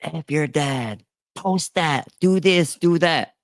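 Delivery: a lightly voiced scorned ex; mocking scorned woman. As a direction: imitate her egging it on, rising. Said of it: "a scorned woman".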